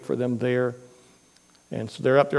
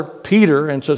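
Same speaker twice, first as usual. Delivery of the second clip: usual speaking voice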